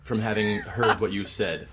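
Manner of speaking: usual speaking voice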